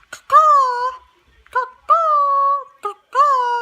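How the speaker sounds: very high loud cawing; a harsh crow call; shrill loud crow caws. Shown as a demonstration. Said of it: "animal impressions".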